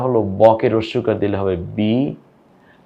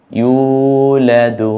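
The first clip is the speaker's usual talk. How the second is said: stretched out; 2 semitones higher, 4 dB louder, much slower than usual. Shown as a demonstration. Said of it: "এটা কিন্তু আমি টানি নেই".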